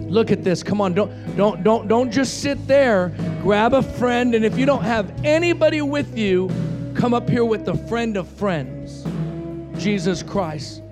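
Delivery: loud, urgent preacher's call; forceful call to come forward; altar-call exhortation. Real, not acted. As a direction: urge them out of their seats, loudly. Urges congregation to come forward.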